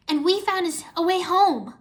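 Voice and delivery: high, loud happy announcement; excited. A narrator's reading. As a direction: share good news eagerly. Friend shares good news.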